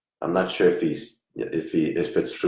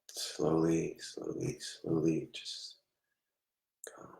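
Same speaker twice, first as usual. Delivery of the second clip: hushed soothing; 12 dB quieter, slower than usual. Real, not acted. Medium soothing a distressed spirit.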